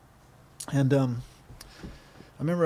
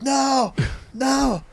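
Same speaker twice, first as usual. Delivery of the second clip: mock protest; 8 semitones higher than usual. Retold refusal of a ride.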